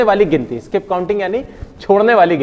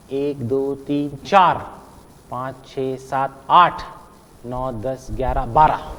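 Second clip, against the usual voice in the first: low, hushed count rising out on चार, आठ, बारह; every fourth number stressed; 6 semitones lower, 5 dB quieter, slower than usual. Shown as a demonstration. Teacher repeating the count in Hindi, stressing चार, आठ, बारह. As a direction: keep the count soft, land hard on चार, आठ, बारह. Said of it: "हर चौथे को गिना".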